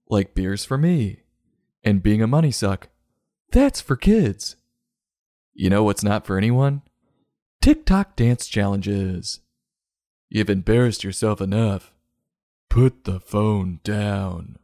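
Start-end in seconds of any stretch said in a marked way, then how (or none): 3.5-4.5 mock high sneer. Mocking emphasis. 12.7-14.6 slow stern order. Acted. Scolding viewer.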